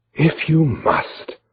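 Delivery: haughty lady sighing her consent; reluctant sigh. A narrator's reading. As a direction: give in with a bored sigh. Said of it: "the grand lady sighed".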